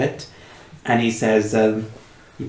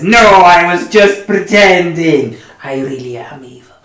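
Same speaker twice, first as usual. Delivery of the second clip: hammy villain voice; 6 semitones higher, 6 dB louder than usual. Acted. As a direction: ham up the villain.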